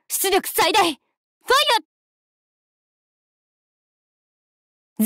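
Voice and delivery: high, ringing firing call; battle shout. Acted. Attendant shouts the fire command at full power in battle. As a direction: call the shot out full force.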